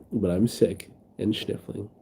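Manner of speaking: normal talking voice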